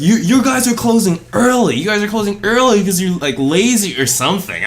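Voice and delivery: high, whiny accusing customer voice; mocking angry customer.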